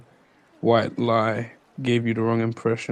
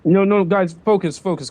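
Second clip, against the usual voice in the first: higher, louder, urgent interjection; urgent call to order; 6 semitones higher, 5 dB louder than usual. Acted. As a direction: rein the group in urgently. Calling bickering squad to order.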